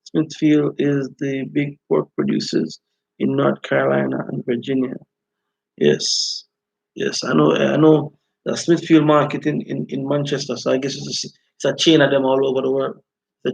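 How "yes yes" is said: ordinary talk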